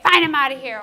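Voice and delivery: loud imitated storming-off; defiant outburst. Trainer voicing a child who might run away, mock defiant outburst. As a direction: act out a kid bolting, loud and abrupt. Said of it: "he might run away".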